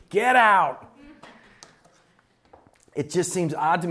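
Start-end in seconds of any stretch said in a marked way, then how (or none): 0.1-0.9 louder, higher mock "get out"; playful fake outrage at the kid; mock exasperation. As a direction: throw out a mock-outraged retort. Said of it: "I was like".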